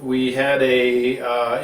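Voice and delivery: usual speaking voice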